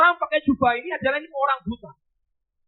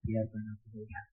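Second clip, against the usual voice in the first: hushed plea; 17 dB quieter than usual. Acted. Blind man's plea.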